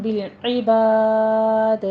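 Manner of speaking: بالعباد slow, with a bounced final d; tajweed recitation. Shown as a demonstration. Teacher recites بالعباد with qalqala. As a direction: stretch بالعباد and echo the last d. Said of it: "دال کا ہم قلقلہ کریں گے".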